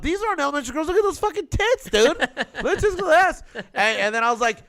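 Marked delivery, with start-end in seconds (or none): 0.0-3.4 high, excited mock outrage; high outraged teasing. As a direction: protest it loud and high, grinning. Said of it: "I'm like".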